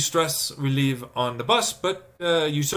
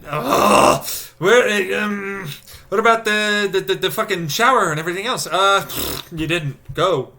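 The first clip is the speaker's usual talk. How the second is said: loud, higher, hurried scramble; frantic rush; 6 semitones higher, 6 dB louder than usual. Retold or imitated. Reliving a rushed morning, frantic and loud. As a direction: race through the morning panic.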